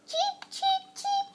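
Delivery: very high tiny cheeps; high little chick peeps. Acted. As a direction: peep like a baby chick. Child imitating chicks.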